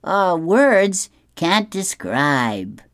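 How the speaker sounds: high, stretched-out mock admiration; savvy and tactful. A narrator's reading. Boy tactfully answers girl about new hair, high drawn-out praise. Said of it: "he's savvy and tactful".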